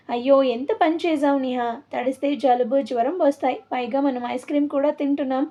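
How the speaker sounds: plain reading voice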